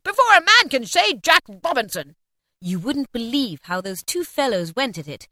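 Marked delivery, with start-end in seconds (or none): 0.0-2.2 high, loud, rollicking order; hearty old master calling out; jovial cry. A narrator's reading. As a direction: hurry them along merrily. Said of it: "cried old Fezziwig".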